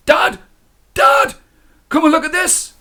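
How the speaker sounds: high, loud calls for his father; shouting for dad. Acted. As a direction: yell out urgently for dad. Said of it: "He shouted for his father".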